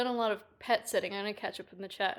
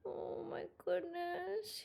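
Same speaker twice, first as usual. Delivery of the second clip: pitched-up, hushed slow "Oh my goodness"; high, soft drawn coo; 8 semitones higher, 8 dB quieter, slower than usual. Real, not acted. Pet sitter hugging the cat, cooing high.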